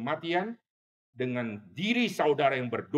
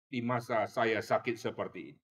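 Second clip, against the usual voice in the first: ordinary talk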